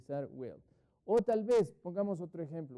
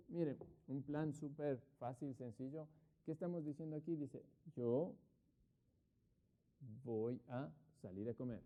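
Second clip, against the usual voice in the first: usual speaking voice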